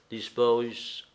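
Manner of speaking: plain reading voice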